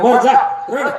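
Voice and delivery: loud, high fed-up snap; women lose patience and yell at him; mock-angry shout, high and loud; exasperated women. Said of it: "महिला म्हणे".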